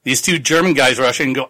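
usual speaking voice

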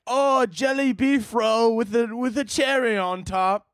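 high, slow cartoon British accent; silly British accent. British person naming a favorite dessert, silly cartoon British accent. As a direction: draw it out in an exaggerated British accent. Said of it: "people have a fucking silly little accent".

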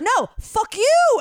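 loud, high, drawn-out re-enacted retort; mock angry escalation. Caller escalating the curse exchange, imitated loud anger. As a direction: top the last insult, hammed-up.

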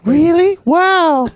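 put-on high falsetto girl voice; man doing a woman's voice. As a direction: gush like an excited young woman. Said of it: "You do a girl voice better than me".

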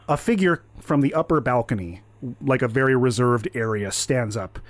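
ordinary talk, nothing marked

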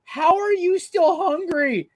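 high, slow, drawn-out disbelief; asking the coworker in total disbelief; disbelieving question. Retold or imitated. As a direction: stretch it out in amazement. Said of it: "And looking at him, like".

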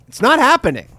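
high-pitched mock defiance; defiant refusal. Parents answering the mayor's vaccine plea, mock defiant.

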